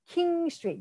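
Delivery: "King Street" with a hard K, pitched high; "King Street" held up high, K sounded; standard pronunciation. Shown as a demonstration. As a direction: say "King Street" clearly as the intended name. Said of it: "they're saying King Street".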